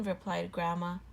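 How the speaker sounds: usual speaking voice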